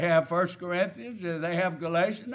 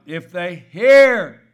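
"hear" held long and loud; stress on hear; 14 dB louder, slower than usual. Real, not acted. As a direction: lean hard on "hear" and stretch it. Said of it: "I emphasize that word hear".

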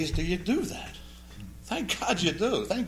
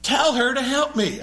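raised and slow, a bossy mock demand; 7 semitones higher, 3 dB louder than usual. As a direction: play the frazzled hostess scolding. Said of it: "she didn't say that politely or quietly".

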